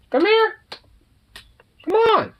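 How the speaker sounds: sing-song it up high to lure the pet; high-pitched pet-calling voice. Real, not acted.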